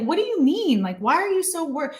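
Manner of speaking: high, mock-exasperated questions; incredulous questioning. Daughter asking mom why she worries, incredulous.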